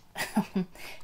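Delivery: brief whispered chuckle; a small breath of laughter; short laugh. Real, not acted. Laughs at own joke.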